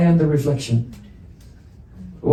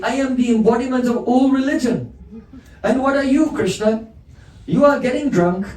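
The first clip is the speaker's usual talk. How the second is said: much higher, haughty, laughing scold; mocking proud Brahmin; 7 semitones higher than usual. Madhu Mangal boasting and scolding Krishna, haughty high sneer. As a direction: puff up and sneer like a self-important priest.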